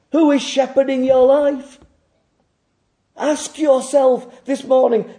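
high, loud, driving pulpit voice; fervent sermon climax; full-force preaching. Acted. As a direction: hammer each "must be" home.

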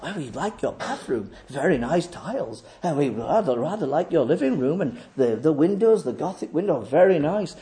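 soft, low house-guest admiration; polite visitors. Retold or imitated. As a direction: imitate guests mildly approving of the rooms.

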